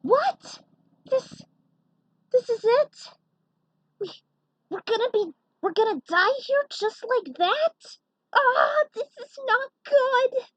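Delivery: terrified heroes about to ram the satellite; panicked heroes. Acted. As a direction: panic as the ship is about to crash. Heroes panic before crash.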